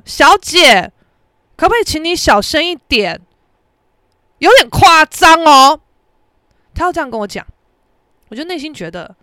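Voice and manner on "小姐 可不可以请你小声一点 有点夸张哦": mock polite scolding, raised pitch; reproving man. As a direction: imitate him chiding, stretching the last phrase louder. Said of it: "他就回头跟我说". (模仿先生假客气地训人)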